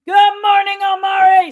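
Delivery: high, loud sing-song hello; cheery shout-out to a chat viewer; big shouted greeting. Real, not acted. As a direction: belt the good morning across the room.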